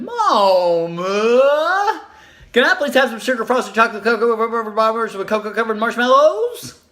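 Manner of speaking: put-on high, drawn-out begging child voice; pleading little child. Little girl begging her mom for cookies, drawn-out pleading. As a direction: stretch it out like a small kid begging.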